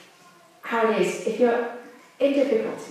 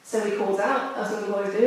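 normal talking voice

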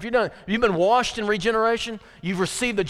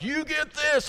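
mock scream; 9 semitones higher, slower than usual. Preacher mimicking a yelled text, mock screamed. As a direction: pretend to scream the words at someone. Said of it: "I'm screaming at you".

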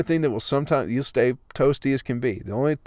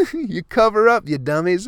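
laughing, louder scolding jab; mocking scold; 8 semitones higher, 4 dB louder than usual. Real, not acted. Vlogger mocking people who stay cold, laughing scold.

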